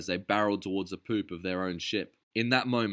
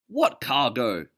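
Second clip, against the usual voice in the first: slow, louder incredulous question; protesting; 5 semitones higher, 8 dB louder, slower than usual. A narrator's reading. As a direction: ask it in disbelief. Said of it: "Sam protested".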